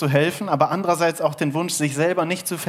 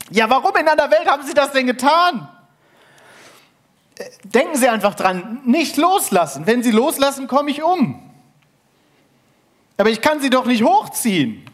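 hohe, laute, verzweifelte Stimme; panisch; 10 semitones higher, 6 dB louder than usual. Acted. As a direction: ruf in Panik hinunter. Wandersmann panisch am Seil. (panisch rufen, hoch und laut)

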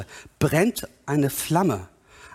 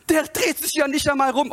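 higher-pitched mock indignation; acted protest, pitched up; objector's complaint; 8 semitones higher than usual. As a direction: complain as the affronted bystander. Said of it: "Und du sagst".

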